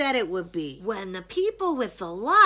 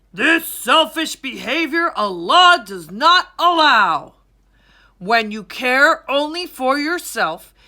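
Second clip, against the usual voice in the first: louder, commanding royal address; proclamation king; 9 dB louder than usual. A narrator's reading. King addresses the crowd about zakat, loud commanding proclamation. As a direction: proclaim it like a king to his people. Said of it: "the king said to the crowd".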